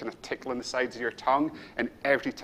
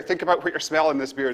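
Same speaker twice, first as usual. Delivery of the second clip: ordinary talk, nothing marked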